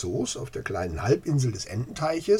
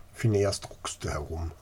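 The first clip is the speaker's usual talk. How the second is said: ordinary delivery, nothing marked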